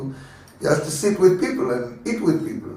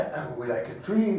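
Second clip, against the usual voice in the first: ordinary talk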